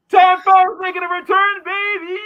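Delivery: high loud hype shout; hype announcer. Acted. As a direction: announce it like a wrestling hype man. Hyped announcement.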